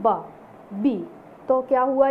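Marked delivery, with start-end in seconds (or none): 0.0-0.2 बा as the sound of B, not its name; letter sound demo. Shown as a demonstration. Teacher shows the first sound of boy, demonstrating बा. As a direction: sound out बा, a voiced b with an open vowel. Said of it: "इसकी जो ध्वनि है".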